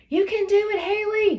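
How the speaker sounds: high, loud shout of support; a friend shouting up from below; encouraging shout. A narrator's reading. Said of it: "Graceland shouted from below".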